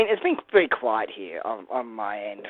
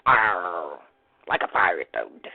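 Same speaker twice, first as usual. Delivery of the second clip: pirate arr; 10 semitones lower, slower than usual. Pretend. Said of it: "pirate accent".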